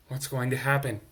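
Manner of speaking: ordinary delivery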